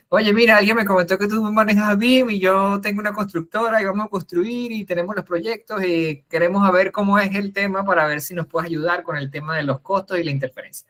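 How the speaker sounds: a friendly client's request in a mock Chilean accent; imitating a Chilean accent. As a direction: voice a Chilean builder in a so-so Chilean accent, rising then settling. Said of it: "no me sale muy bien el acento chileno".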